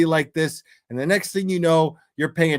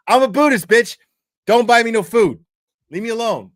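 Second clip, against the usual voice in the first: higher, louder shout of refusal; mock yell; 6 semitones higher, 7 dB louder than usual. Man yells at a woman offering to pay, mock yell. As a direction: bark it like you're fed up.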